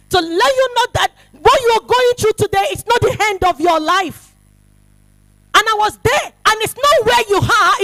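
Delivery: high, loud preaching with a shout. Acted. Preacher telling the youth it is not the end, loud and high. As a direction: proclaim it to lift them up. Preaching at full force to congregation.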